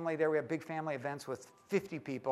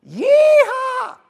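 huge high shouted whoop; cowboy yeehaw; 18 dB louder than usual. Retold or imitated. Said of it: "what's on his answering machine".

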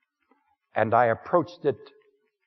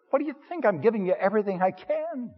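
higher, pleading, mildly exasperated; exasperated reply; 10 semitones higher, faster than usual. Acted. Man answering his restless woman, higher and mildly exasperated. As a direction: plead that you're giving all you have.

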